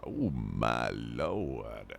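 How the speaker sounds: mock shocked lady. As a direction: stretch it out like a scandalised old lady, ham it up. Echoing the game character's gasp.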